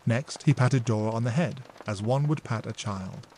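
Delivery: usual speaking voice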